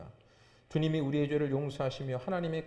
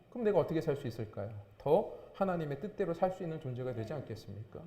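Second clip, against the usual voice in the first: ordinary delivery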